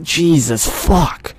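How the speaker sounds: high, slow, drawn-out shock; real fright, stretched words; shaken exclamation. Real, not acted. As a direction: groan it out, still rattled by a scare. Reeling after the jump scare.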